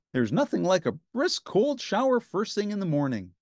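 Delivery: high, bright puppet voice for Ernie. A narrator's reading. Ernie teasing Bert, high cheerful puppet voice. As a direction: play the giddy Ernie.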